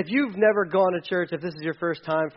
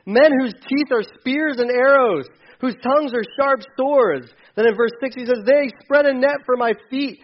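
preaching at full force; 8 semitones higher, 10 dB louder than usual. Acted. Preaching David's lament loudly.

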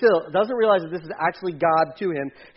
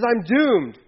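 doomed cry; 6 semitones higher, 6 dB louder than usual. Retold or imitated. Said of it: "he says".

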